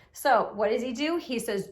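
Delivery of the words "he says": ordinary delivery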